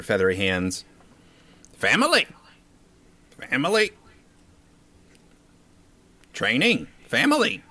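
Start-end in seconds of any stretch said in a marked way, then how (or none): 1.8-7.6 high, squawky bird calls; excited bird creature. Acted. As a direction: squawk it out, eager. Bird creature shouts family.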